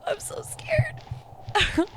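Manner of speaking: slow, high breathy whisper of fear; scared whisper. Real, not acted. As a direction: breathe it out, terrified. Frightened during horror game.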